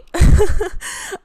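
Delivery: loud, high laugh breaking through tears; sudden emotional laugh while welling up; teary laugh. Real, not acted. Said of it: "I'm gonna cry".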